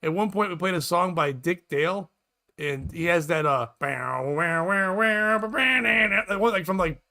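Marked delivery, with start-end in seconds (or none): 3.8-6.2 twangy surf guitar done by mouth, rising higher; imitated guitar riff. Reminding co-host of the Dick Dale song, guitar imitation. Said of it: "the guitar".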